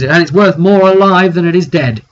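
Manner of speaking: rowdy crowd shouting over the chase; crowd yelling. Retold or imitated. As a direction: yell it over a mob.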